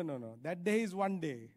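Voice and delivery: ordinary talk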